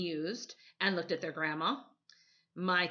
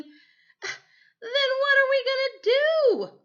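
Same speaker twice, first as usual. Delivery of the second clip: much higher, louder, slow whining wail; howling boys; 10 dB louder, much slower than usual. Acted. Boys howling at grandmother over their lost gadgets. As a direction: wail it like a kid denied his toys. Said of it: "the boys howled".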